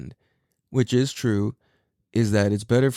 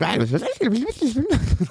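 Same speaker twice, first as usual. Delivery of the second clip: high, squeaky gibberish babble; toddler babble imitation; 14 semitones higher than usual. Dad imitating his toddler's random babbling rant, high squeaky gibberish. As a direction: copy a toddler's nonsense babbling, much higher. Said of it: "he's like".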